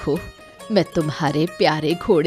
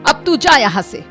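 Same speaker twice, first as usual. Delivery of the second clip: high, firm order to leave; stern dismissal; 8 semitones higher, 4 dB louder than usual. Acted. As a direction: send them off sharply. Mother sends her son away. (सख़्ती से भगाते हुए)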